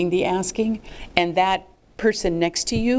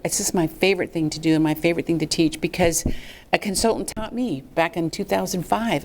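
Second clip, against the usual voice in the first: usual speaking voice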